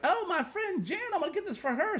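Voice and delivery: high, chirpy imitation of the girl; mock girl. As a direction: lightly mimic her sweet excuse. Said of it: "she's like".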